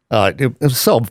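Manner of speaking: ordinary talk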